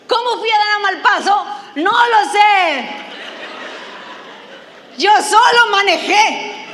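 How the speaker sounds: loud laughing disbelief, slowing down; excited disbelief. Real, not acted. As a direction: cry out, amazed at your own mistake. Excited disbelief at getting lost.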